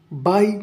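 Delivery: बाई alone, as one clear beat; isolated syllable. Shown as a demonstration. Teacher showing one syllable to students, बाई said on its own. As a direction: set बाई apart with a pause after. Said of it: "या syllable है".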